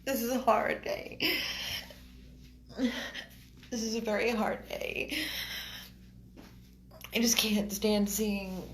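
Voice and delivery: high, strained distress; upset, near tears. Real, not acted. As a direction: struggle through it, overwhelmed by sorrow. Overwhelmed by suffering animals.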